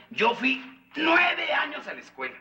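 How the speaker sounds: "nueve" pitched high and stretched out; stress on nueve. Acted. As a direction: proudly hit the word "nueve". Boasting of his schooling. (énfasis agudo y alargado en "nueve")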